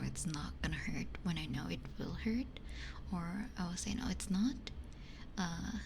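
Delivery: ordinary delivery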